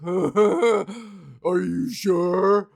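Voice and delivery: very slow, drawn-out, leering comic; a sleazy club comic needling a couple; smug comedian. Retold or imitated. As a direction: stretch it out like a hack teasing the crowd. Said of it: "the comedian will always be like".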